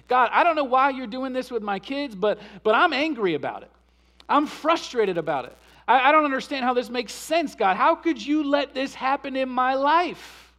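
loud, pleading prayer to God; demo of an angry lament prayer. Shown as a demonstration. Preacher voicing an angry prayer to God, loud and pleading. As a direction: pour out frustration at God. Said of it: "Lament sounds like this".

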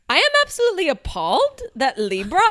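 high, loud, badly faked Australian accent; mock appalled Aussie mum, overdone. Reluctant cohost tries a clumsy Australian accent. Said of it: "I am so bad at Aust".

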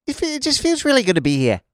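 high, loopy Kiwi accent; hammed-up New Zealand accent. Shown as a demonstration. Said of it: "your best New Zealand accent".

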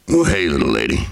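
low drawling cowboy voice; Sam Elliott impression. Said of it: "every lady in Sam Elliott's world is little".

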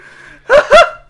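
high, loud cackling laugh; laugh impression. Shown as a demonstration. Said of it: "I also laugh like that".

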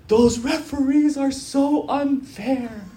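high, slow mock-tearful whine; hammed-up sobby protest, drawn out; retold crying complaint. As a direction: imitate a kid wailing over an injustice.

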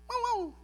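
very high, small yap; high-pitched imitated puppy bark; little dog's bark. Little dog barks at the door. (ladrido agudo de perrito)

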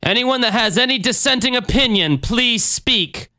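high-pitched orator's call; preacher addressing crowd. Acted. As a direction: address the congregation from the pulpit. Reading Jim Jones addressing the congregation.

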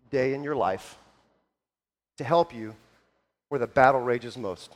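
low, soft lead-in to prayer; solemn, hushed. Real, not acted. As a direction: settle the room gently, with pauses. Settling the room into prayer.

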